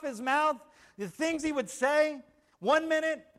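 high, loud pulpit delivery; emphatic preaching. Real, not acted. Preacher telling the church of Peter's transformation, emphatic preaching. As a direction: drive each phrase home with force.

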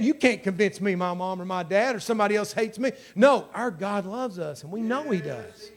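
ordinary delivery

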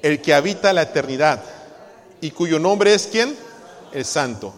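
loud, high proclaiming of scripture; loud reading. Real, not acted. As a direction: proclaim the verse with rising force. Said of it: "Leamos juntos".

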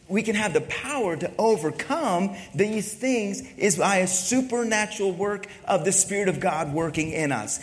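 normal talking voice

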